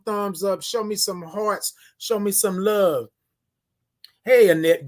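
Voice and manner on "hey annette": ordinary talk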